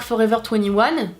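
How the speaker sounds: English brand name with French r sounds; ordinary French accent. Shown as a demonstration. Vlogger showing viewers how the brand sounds in an ordinary French accent. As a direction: say "Forever twenty-one" in a French accent. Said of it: "là je le dis avec l'accent genre normal".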